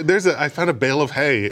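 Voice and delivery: normal talking voice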